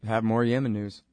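ordinary delivery

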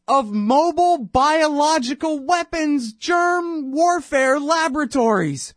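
slow, high, drawn-out sarcastic disbelief; incredulous mockery. Real, not acted. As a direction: stretch every word out high, as if it's absurd. Mocking Powell's UN drawings.

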